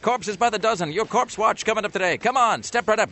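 high, loud sideshow barker pitch; loud carnival barker. Pretend. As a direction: hawk it like a carnival barker to passersby. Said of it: "sometimes I sound like a carnival barker".

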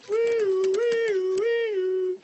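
high loud wee-wee siren; siren imitation.

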